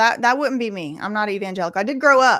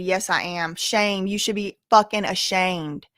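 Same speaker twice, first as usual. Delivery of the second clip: usual speaking voice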